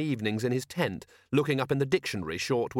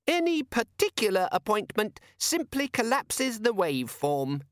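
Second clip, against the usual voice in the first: very high and slow, lecturing; pedantic squeaky demon; 10 semitones higher than usual. Acted. The demon lecturing Vimes on appointments, squeaky and slow. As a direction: spell it out like a fussy know-all.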